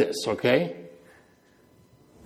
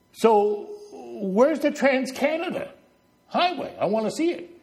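voiced visitor's question; 7 semitones higher than usual. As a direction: act out a visitor asking, pitched up. Acting out visiting politician's question.

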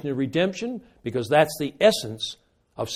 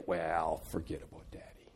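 dismissive aside; 10 semitones lower, 15 dB quieter than usual. Retold or imitated. Hypothetical children giving up hope.

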